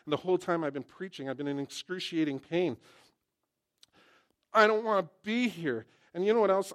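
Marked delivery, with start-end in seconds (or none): 4.5-6.7 higher and louder, petulant whine; sulky complaint. Acted. Preacher acting his reluctant self to the congregation, sulky and louder. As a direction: sound like a grumpy kid who won't go.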